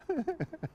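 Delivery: short high-pitched chuckle; tickled giggle after the payoff; amused laugh. Real, not acted. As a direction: laugh at your own punchline. Amused at his own story's payoff.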